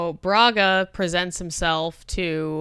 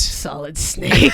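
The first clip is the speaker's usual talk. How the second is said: Solid Snake voice; 3 semitones lower, 6 dB louder, slower than usual. Pretend. Solid Snake impression, slow.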